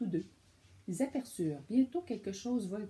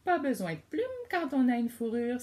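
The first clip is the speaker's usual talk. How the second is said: louder, a proud exclamation; exclaiming skunk; 4 semitones higher, 7 dB louder than usual. Acted. The skunk tells the cub off loudly. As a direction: play Ciboulette bragging about her fur. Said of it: "s'exclama Ciboulette la moufette".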